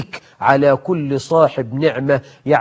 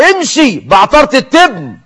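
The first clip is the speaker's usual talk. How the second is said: mocking jeer; 8 semitones higher, 4 dB louder than usual. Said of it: "وبعضهم يقول له".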